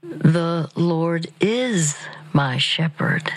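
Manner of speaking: slow, hushed, drawn-out "The Lord is my shepherd"; said very slowly. Shown as a demonstration. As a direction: breathe it out word by word, very slowly. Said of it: "You say it very slowly".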